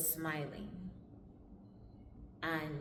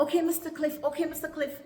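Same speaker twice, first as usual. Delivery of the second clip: high, loud, hurried-to-please repetition; flustered placating; 10 semitones higher, 7 dB louder than usual. Retold or imitated. Visa official gives in to dad, flustered placating. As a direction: mimic an official rushing to appease. Staffer hastily placates the star.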